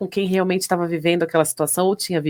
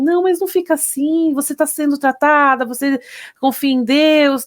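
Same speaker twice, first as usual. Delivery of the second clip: lightly voiced consoler, pitched higher; higher-pitched mock reassurance; well-meaning consoler; 7 semitones higher than usual. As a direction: gently imitate people offering higher-pitched reassurance. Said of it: "elas vão falar assim".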